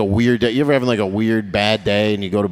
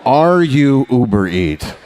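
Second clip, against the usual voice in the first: put-on slow drawn-out Indian accent, falling; slower delivery guy accent; 3 semitones higher, 4 dB louder, slower than usual. Comic repeats the driver's question in a slow Indian accent. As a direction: repeat the question slowly, savouring it.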